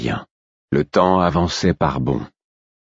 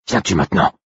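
higher, rough-edged insistent question; insistent wolf; 4 semitones higher than usual. A narrator's reading. Wolf pressing his brother to come, insistent. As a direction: press him for an answer, roughly. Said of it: "Mon propre loup qui m'appelait ainsi".

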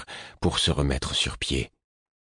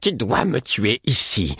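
raised, slow, indignant insistence; higher indignant woman's voice; indignant demand; 6 semitones higher than usual. A narrator's reading. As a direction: demand it slowly, outraged. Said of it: "de l'indignation dans sa voix".

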